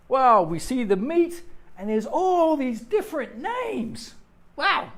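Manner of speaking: loud, high, drawn-out wow of wonder; mock amazement. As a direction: marvel like a wide-eyed shopper. Mock amazement at supermarket meat names.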